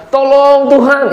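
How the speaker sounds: high, drawn-out plea; mock desperate cry, not full volume; imitated crying out. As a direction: call out for help, stretching the words. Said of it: "ini dikatakan berteriak-teriak ya".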